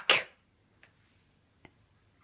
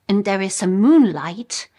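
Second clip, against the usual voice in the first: ordinary talk, nothing marked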